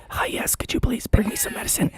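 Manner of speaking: lost-voice whisper; acting out a request with no voice left; voiceless whisper. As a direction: whisper it, all air and no tone. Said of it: "I couldn't talk".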